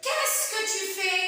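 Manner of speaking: slow rising question tune; question intonation. Shown as a demonstration. Teacher demonstrating a question's rising intonation. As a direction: ask it with a clear lifting question. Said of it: "Donc la phrase interrogative".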